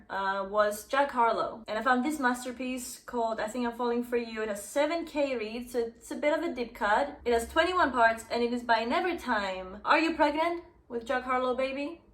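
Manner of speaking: ordinary talk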